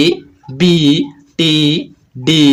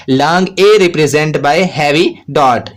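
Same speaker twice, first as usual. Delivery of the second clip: slow dictation; much slower than usual. Shown as a demonstration. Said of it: "ए को second place में Gary dot से जाहिर करेंगे".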